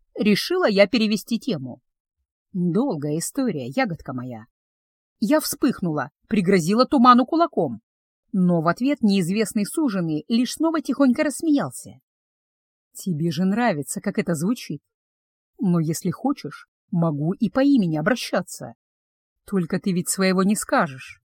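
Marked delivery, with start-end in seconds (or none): 2.5-4.5 much lower and softer; teasing male endearment. Acted. Betrothed calls the witch his berry, low and soft. 12.9-21.2 teasing betrothed. Acted. Male figure's voice.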